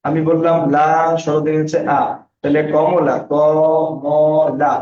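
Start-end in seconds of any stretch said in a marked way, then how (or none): 3.3-4.7 very slow, each syllable split apart; teacher sounding out a word slowly. Shown as a demonstration. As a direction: spell out Kamala as কম-লা, slowly. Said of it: "কয়টা আছে?".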